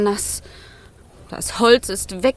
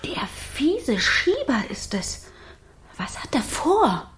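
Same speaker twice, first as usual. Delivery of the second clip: whisper; 5 dB quieter than usual. Acted. Fire frightened of the villain.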